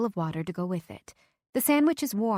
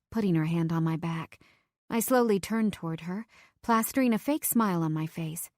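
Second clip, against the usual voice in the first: usual speaking voice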